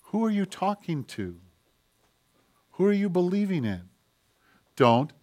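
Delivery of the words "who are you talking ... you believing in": soft, measured questions with long pauses; quietly challenge, letting each question hang; lightly acted voice of God, hushed; God answering. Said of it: "And God answers".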